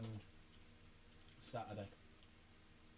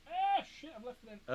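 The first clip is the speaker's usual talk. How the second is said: much higher, startled realisation; sudden dismay; 7 dB quieter than usual. Real, not acted. Forgetting the water, a high startled exclamation. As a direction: yelp as it dawns on you.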